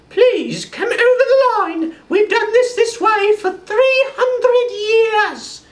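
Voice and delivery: put-on very high, slow English accent; fluting English accent, close to tears; posh English servant. French court servant mocked with an English accent. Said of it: "were all English".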